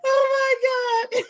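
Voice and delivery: high, slow laughing cry; laughing exclaim. Real, not acted. Laughing at the exhausting stairs, high cry. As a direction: burst out laughing.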